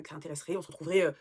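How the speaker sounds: usual speaking voice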